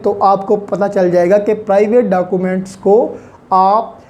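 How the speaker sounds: ordinary talk, nothing marked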